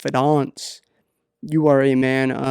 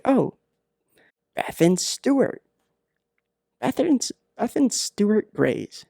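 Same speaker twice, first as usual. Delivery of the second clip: higher, pleased discovery of a name; a lightly hammed-up eager find, pitched up; mock eureka; 7 semitones higher than usual. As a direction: play it like you just cracked the case. Finding her parents' names online.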